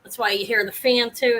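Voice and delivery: ordinary talk, nothing marked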